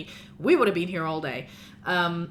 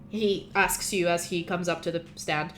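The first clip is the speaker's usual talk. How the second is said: ordinary talk, nothing marked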